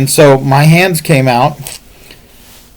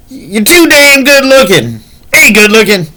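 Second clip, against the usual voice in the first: much higher, louder put-on voice; a friend's teasing compliment, imitated much higher; Steve's way of talking; 12 semitones higher, 6 dB louder than usual. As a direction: pitch it way up, mimicking a friend's teasing. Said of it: "the way he talks".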